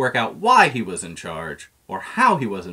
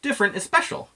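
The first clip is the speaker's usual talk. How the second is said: excited; faster than usual. Acted. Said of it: "said Scooter".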